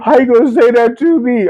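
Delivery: high whiny mock mom; imitating mom. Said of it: "Your mom would just be like".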